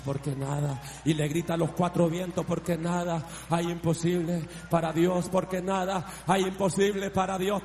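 low chant-like repetition gathering force; building declaration. Real, not acted. Preacher crying the verse to the four winds, building declaration. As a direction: start low, climb steadily with each repeat.

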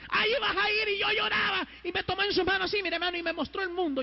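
high, ringing proclamation to a crowd; full-force preaching. Acted. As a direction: preach it out on a high sustained pitch. Preaches God's words at full force.

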